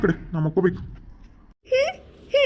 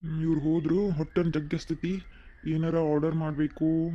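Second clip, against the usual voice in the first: ordinary talk, nothing marked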